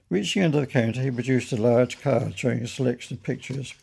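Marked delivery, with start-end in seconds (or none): none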